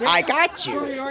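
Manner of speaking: high and slow, drawn out teasingly; a slow, high tease; squeaky mock reply. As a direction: stretch it up high like a cartoon. Mock reply, throne prank.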